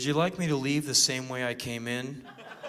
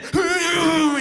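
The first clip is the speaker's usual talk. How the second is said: high, loud mock wail; fleeing shriek; 14 semitones higher, 9 dB louder than usual. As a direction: let out a hammed-up getaway cry. Imitates intruder shrieking as he flees.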